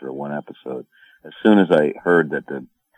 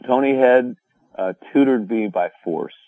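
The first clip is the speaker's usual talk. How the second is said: normal talking voice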